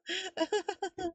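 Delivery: high, quiet nervous giggle; nervous laugh. Acted. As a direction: let out a small uneasy laugh. Nervous laugh ending acted reaction.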